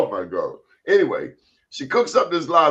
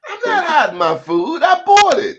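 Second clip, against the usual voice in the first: high, loud indignant protest; 10 semitones higher, 4 dB louder than usual. Acted. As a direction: defend it, offended. Indignant defense of own food.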